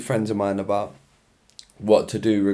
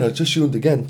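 ordinary talk

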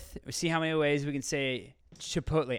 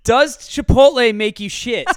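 mocking friend; 5 semitones higher, 10 dB louder, slower than usual. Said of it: "Wesley comes up with shit like".

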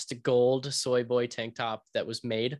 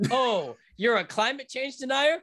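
mock incredulous; 11 semitones higher than usual. Mock questioning before the snip.

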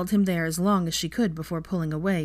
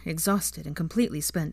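ordinary delivery, nothing marked

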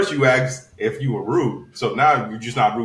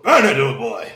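loud mocking British accent; put-on British call of the name; 5 dB louder, slower than usual. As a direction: mimic a phone greeting in a bad British accent. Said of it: "You put on that shitty ass British accent".

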